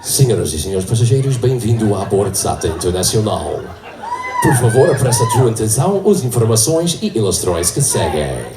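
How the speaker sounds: low, smooth airline announcement; slick pilot voice. Retold or imitated. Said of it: "that real slick pilot voice".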